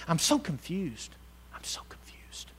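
mock complaint fading from soft voice to whisper. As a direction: let the whine die into a whisper. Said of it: "we say it over and over".

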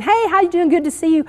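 high, passing hello; lightly acted chirpy small talk; imitated cheery greeting. Imitates a quick Sunday morning greeting.

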